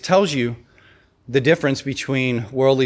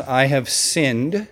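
hollow confession; much slower than usual. Retold or imitated. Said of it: "you will a lot of times hear the words".

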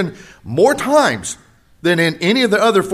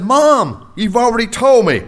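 retold protest to mom; 6 semitones higher than usual. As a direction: plead like a son who's heard it enough. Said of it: "and I said".

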